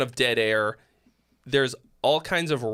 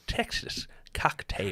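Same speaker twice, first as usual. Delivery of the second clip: slow, whispered attempt at a Texas accent; a stab at a Texas accent that misses; failed Texas accent; 7 semitones higher, 7 dB quieter, slower than usual. Shown as a demonstration. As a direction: drag out Texas Cocktail in a half-botched Texas drawl. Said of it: "That wasn't a Texas accent".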